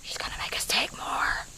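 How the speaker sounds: soft unvoiced whisper. Real, not acted. Vlogger whispering about sister's photos, hushed whisper. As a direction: whisper so she won't hear.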